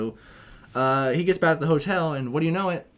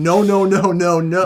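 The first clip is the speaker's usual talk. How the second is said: louder, higher frantic protest; panicked no; 5 semitones higher, 10 dB louder than usual. Retold or imitated. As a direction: cry out in mock panic. Peter panicking as photo taken.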